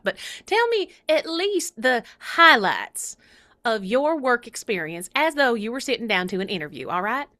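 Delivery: puppet interviewer with a Southern lilt; swooping pitch, emphatic put-on accent; Proxy. Puppet co-host asks Charlie about past work, emphatic accented voice. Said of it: "she's gonna be asking me the questions".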